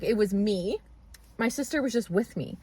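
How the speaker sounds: ordinary talk, nothing marked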